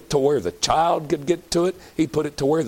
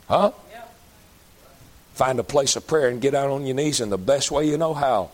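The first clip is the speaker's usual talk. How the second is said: ordinary talk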